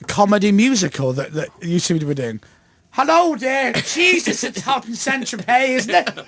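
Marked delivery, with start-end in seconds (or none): 2.9-6.2 put-on higher-pitched comic Irish accent; mock Irish accent. Host spoofing Irish locals in Saint-Tropez, mock Irish accent. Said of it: "That's how Irish people talk".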